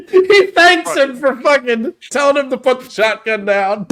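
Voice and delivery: high laugh, words through it; laughing hard and high; real laughter. Real, not acted. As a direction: crack up as you tell it. Cracking up over the scene.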